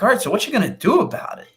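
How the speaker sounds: lively retold "well, go on then"; retold challenge. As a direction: throw it out as an expectant, high-pitched challenge. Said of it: "I'm sitting there like".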